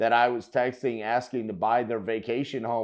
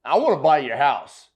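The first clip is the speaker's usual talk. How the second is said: loud; 3 semitones higher, 8 dB louder than usual. Retold or imitated. Host showing how the caller should talk, loud and blunt. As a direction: blurt it out loud and direct. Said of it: "brother, just get to it".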